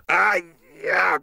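sudden high ouch; pained yelp. Acted. Cop hurt by an old object, sharp pained yelp. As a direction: yelp as if stung.

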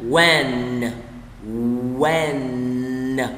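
When with a long, humming n at the end; held final N. Shown as a demonstration. Teacher showing learners the final N sound, held n. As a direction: draw out When and linger on its final n. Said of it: "Âm N đọc là N".

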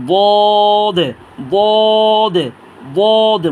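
বদ with a heavy onset and long, level vowel; thick, heavy. Shown as a demonstration. Teacher models a letter for learners, thick and drawn out. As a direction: press tongue edge to molars, hold বদ. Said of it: "মোটা আওয়াজে উচ্চারণ করব".

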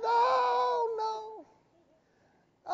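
long, high-pitched drawn-out cry; retold wailing protest. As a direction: stretch out a dramatic cry of refusal. Retells waking with blocked nose.

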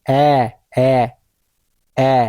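"অ্যা" with the jaw dropped, as in cat; open short-vowel demo. Shown as a demonstration. Teacher showing where the jaw goes, "অ্যা" held open. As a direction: drop tongue and jaw for each separate "অ্যা". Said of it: "চোয়াল নামবে".

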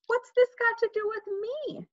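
high-pitched protest of disbelief; indignant. A narrator's reading. Peter is blamed by his mother, indignant.

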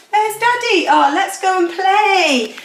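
put-on high cooing baby voice. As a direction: coo it like voicing a toddler. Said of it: "I was like".

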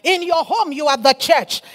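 ordinary talk

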